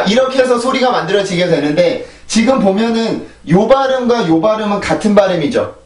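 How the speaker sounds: ordinary talk, nothing marked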